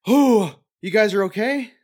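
high, acted surprised question; surprised relief. Survivor emerging from the horse to friends, high acted surprise. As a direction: pitch it up in mock-innocent surprise.

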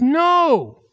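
high, drawn-out cry of protest; retold shout. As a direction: exclaim it in high-pitched disbelief. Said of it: "I shouted. I said".

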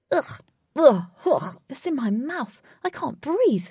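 choking on a mouthful of fur; splutter, then protest in a rising pitch; disgusted spluttering. A narrator's reading. Wraith is swamped in cat hair, spluttering in disgust.

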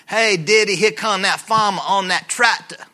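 imitating an Augusta kid's thick Southern dialect. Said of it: "there's a whole dialect from Augusta".

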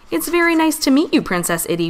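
a touch of bashfulness in a polite hello; high start, settling down shyly on the name. A narrator's reading. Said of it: "Wally said shyly".

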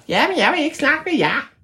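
very high squeaky creature voice; tiny high creature voice; ævle bævle voice. Acted. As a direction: squeak back like a cheeky hidden creature.

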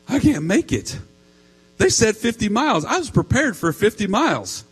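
high, loud crying-wife imitation; whiny crying, hammed up; mock tearful wail. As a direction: play up a tearful, high-pitched protest. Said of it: "then she starts to cry".

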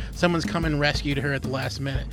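normal talking voice

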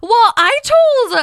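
excited squeal. Real, not acted. Excited jump back into story.

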